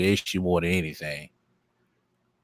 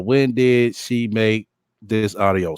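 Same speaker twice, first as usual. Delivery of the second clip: normal talking voice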